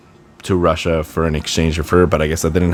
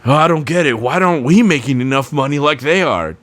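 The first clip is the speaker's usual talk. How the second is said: mock owner's complaint, pitched higher; whiny taco shop owner; 6 semitones higher than usual. Taco shop owner whining about money, mock confused voice. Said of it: "low budget end taco shop being like".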